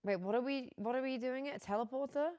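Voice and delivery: usual speaking voice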